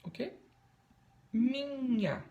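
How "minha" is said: "Minha" high and stretched on MI; stress on MI. Shown as a demonstration. Teacher presenting the next word to pupils, long MI in "Minha". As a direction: draw out the first syllable of "Minha", pitched up. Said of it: "Qual que é a sílaba tônica aí?".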